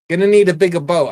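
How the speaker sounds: fast, loud quoted movie line; film quote delivery. Acted. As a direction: punch out the famous line. Quoting Jaws as a quiz.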